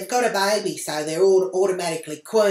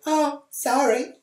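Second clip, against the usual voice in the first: high, slow, mock-innocent sorry; fake sweet apology; 10 semitones higher, 4 dB louder, slower than usual. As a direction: pretend it was an accident. Fake apology after splashing mud.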